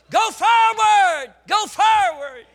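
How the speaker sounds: soaring, loud, drawn-out preaching cry; preaching shout. Real, not acted. Preacher urging the church forward, shouted. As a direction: belt it out to the congregation. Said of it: "preaching at full force".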